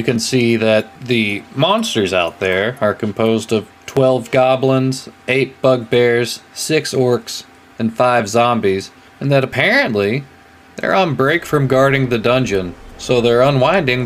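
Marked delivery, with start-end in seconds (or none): none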